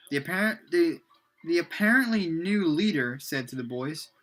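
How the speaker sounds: plain reading voice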